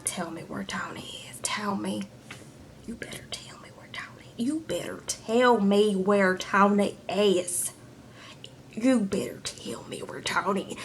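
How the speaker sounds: mock menacing whisper; threatening whisper. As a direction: hiss the demand like a threat. Demanding Tony's whereabouts, threatening.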